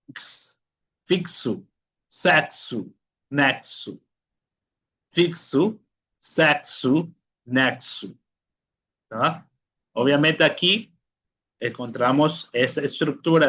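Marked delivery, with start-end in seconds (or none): 0.1-8.1 "fixo, sexo, nexo" as "fiksu, seksu, neksu"; voiceless "ks", then each word separately with it. Shown as a demonstration. Teacher drilling the fourth sound, "fixo, sexo, nexo" with ks. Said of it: "El cuarto sonido es el sonido x".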